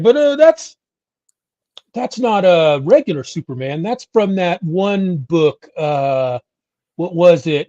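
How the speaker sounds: high, loud, know-it-all nerd voice; mock know-it-all fan. Smug fan correcting the host about Superman, hammed-up. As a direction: lay it on as the smug comic expert. Retelling a comic fan's correction.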